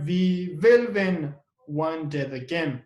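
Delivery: will held and pushed out; stress on will. Shown as a demonstration. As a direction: lean on will, then ease off. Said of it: "we use emphatic do. And here".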